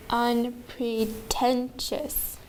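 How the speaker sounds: UNPRETENTIOUS very slow, in four separate beats; bounced syllable by syllable. Shown as a demonstration. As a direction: bounce "unpretentious" slowly for the class. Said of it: "I'm going to bounce them".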